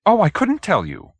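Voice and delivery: high, eager fluttering agreement; flustered little man. Acted. Hoag agreeing to the detective, high and flustered. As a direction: hurry to agree, a bit flustered.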